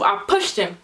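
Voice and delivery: ordinary delivery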